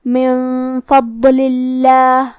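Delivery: level chant of من فضل اللہ with a long hidden nasal n; nasal ghunnah. Shown as a demonstration. Teacher demonstrating ikhfa, very slow nasal tajweed recitation. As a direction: recite من فضل اللہ very slowly, humming the n before f. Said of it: "غنہ کے ساتھ ادا کرنا ہے".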